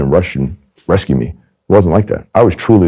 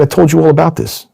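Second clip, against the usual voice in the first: much higher, fed-up complaint; exasperated reproach; 12 semitones higher than usual. Retold or imitated. Agent reproaching the mobster: high exasperated complaint. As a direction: act hurt that he never listens.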